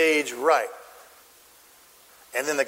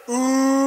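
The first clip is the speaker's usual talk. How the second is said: high, sustained "ooh"; crowd's ooh; 6 semitones higher, 3 dB louder than usual. Acted. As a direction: hold an ooh like a reacting audience. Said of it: "What does all the crowd say?".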